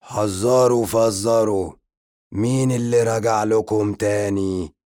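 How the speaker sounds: a zombie crowing at his own return; gleeful zombie greeting. A narrator's reading. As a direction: drag out the riddle with delight. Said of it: "يبتسم بفرحة".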